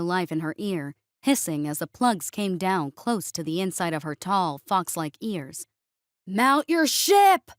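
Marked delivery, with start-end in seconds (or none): 6.3-7.5 loud, slow, high-pitched order; drawn-out sergeant's shout; called-out command. A narrator's reading. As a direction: shout it across the bay.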